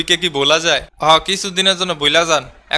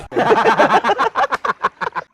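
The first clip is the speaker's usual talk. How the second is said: high cackling laugh; 5 semitones higher than usual. Acted. Laughs at teammate's insult.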